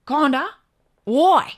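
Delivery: mock Aussie bloke, questioning; Ocker accent. Aussie bloke puzzled by the American woman, broad Australian accent. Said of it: "the most Ocker accent".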